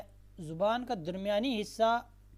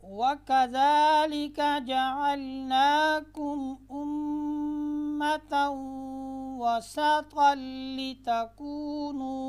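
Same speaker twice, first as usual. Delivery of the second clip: slow, melodic chanted recitation, higher and louder; Quranic recitation; 5 semitones higher, 6 dB louder, much slower than usual. Shown as a demonstration. Teacher opens the lesson with slow melodic recitation. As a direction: recite it slowly with drawn-out tones.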